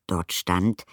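ordinary delivery

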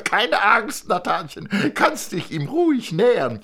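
a proud father showing off his family; thin man presenting wife and son; eager thin man. Acted.

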